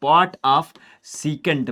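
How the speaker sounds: drawn out; slow dictation. Shown as a demonstration. Teacher repeating the rule to students, slowly.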